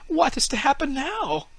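high wondering fir tree voice; puzzled little tree asking itself, voiced high; tree's thoughts. A narrator's reading. Fir tree wonders what the children are doing, high and puzzled. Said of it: "thought the tree".

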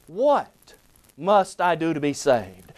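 "What" stressed, then a pause; "What" hit hard, rest of the question falls. Shown as a demonstration. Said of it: "placing emphasis on the different words".

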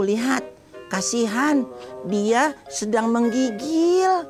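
usual speaking voice

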